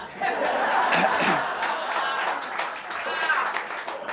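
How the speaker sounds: high, loud, breathless laughter; big laugh. Real, not acted. After the bird's parting shot, helpless high laughter. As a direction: let the laugh burst out. Punchline of the bird story.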